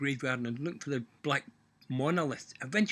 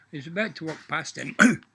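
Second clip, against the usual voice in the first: normal talking voice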